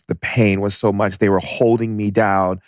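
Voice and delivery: ordinary talk, nothing marked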